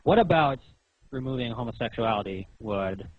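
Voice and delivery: ordinary delivery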